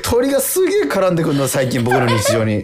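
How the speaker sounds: real laughter breaking into the words; amused laugh through the line; laughing while talking. Real, not acted. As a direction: chuckle through it as you tell the story. Laughing at his own bird story.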